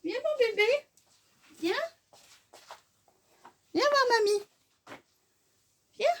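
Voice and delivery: very high cooing pet voice. Real, not acted. Grandma coaxing her cat to come, high cooing baby talk. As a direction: coo sweetly to coax the cat over.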